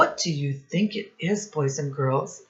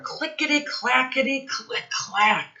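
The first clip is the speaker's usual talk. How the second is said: higher, bouncy clickety-clack; playful clatter; 9 semitones higher than usual. A narrator's reading. Rhythmic sound word in story.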